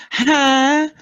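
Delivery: high, slow, puzzled mock listener; confused listener. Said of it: "I get tired of people".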